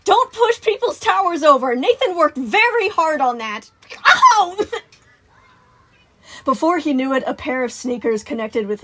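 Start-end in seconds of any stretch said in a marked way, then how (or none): 0.1-3.7 high, loud telling-off; exasperated, raised voice; snapping scolding. Acted. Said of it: "Sun snapped". 4.1-4.6 very high, loud "ow"; sharp pained cry; yelp of pain. Acted. Sun kicked in the back.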